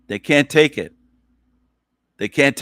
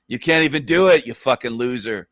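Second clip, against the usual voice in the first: normal talking voice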